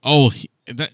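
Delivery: usual speaking voice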